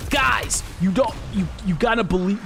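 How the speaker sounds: hyped streamer voice; Lex impression. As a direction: push it up like a meme lord streamer. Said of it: "How do I do Lex?".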